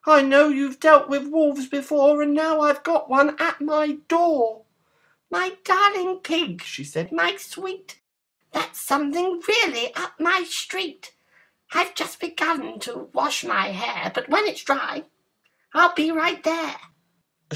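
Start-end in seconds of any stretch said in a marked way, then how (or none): 0.1-4.7 high, loud piggy squeal; panicked pig. A narrator's reading. Pig with wolf at his door, high urgent squeal. 5.3-6.6 put-on very high, gushing girlish voice. A narrator's reading. Girl soothing pig on the phone, very high voice. 7.1-16.8 put-on very high, breezy girlish voice. A narrator's reading. Girl reassuring pig by phone, very high voice.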